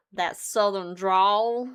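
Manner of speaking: slow, stretched-out Texan drawl; Southern drawl. Shown as a demonstration. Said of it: "Texan one's just a little bit more easy to hit".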